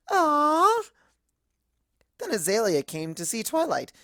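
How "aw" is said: high, loud, cooing aww; gushing aww. Retold or imitated. Narrator coos over the couple's kiss, a high gushing aww. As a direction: melt over the cute kiss. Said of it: "I was all".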